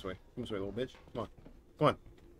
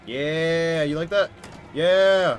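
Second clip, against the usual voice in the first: high, loud gloating taunt; 7 semitones higher, 17 dB louder, much slower than usual. Real, not acted. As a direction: rub it in loudly. Taunting monster behind door.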